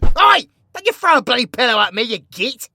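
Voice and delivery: loud, fast "Oi!" and rapid telling-off; startled outburst after a pillow hits him; indignant shout. Acted. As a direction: yelp then scold quickly.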